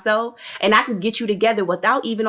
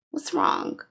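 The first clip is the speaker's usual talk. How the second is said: whispered mock concern; 3 semitones lower, 7 dB quieter than usual. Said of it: "And Stormi's just like".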